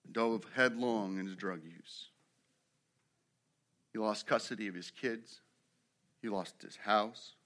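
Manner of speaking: low, hushed sermon illustration; somber storytelling. A narrator's reading. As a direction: tell it softly and gravely, with long pauses. Solemn story of a man's ruin.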